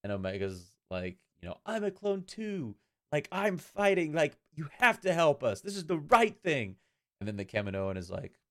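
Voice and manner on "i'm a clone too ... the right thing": put-on much higher, slow pleading girl voice; high, drawn-out voice of a young girl begging for help; earnest little girl. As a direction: beg like an innocent child who wants to do right. Quoting Omega pleading with senator.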